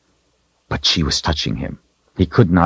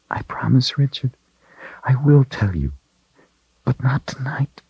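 tearful whisper; 9 semitones higher than usual. A narrator's reading. Tearful female plea, whispered.

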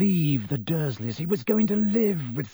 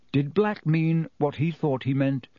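usual speaking voice

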